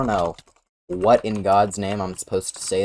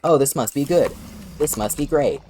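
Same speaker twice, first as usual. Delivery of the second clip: mock excited; 6 semitones higher than usual. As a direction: imitate friends assuming good news. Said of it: "they're like".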